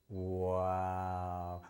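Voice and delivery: low, hushed, drawn-out wow of wonder; amazed wow. Shown as a demonstration. Showing a child how to react to the beep, hushed amazed wow.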